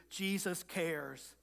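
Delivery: slow and soft, letting each word land; soft reassurance. Real, not acted. Pastor gently reassures his congregation, slow and soft. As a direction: ease it out gently, almost hushed.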